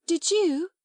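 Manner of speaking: high, frightened little question. A narrator's reading. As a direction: ask it scared. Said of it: "Ermengarde faltered".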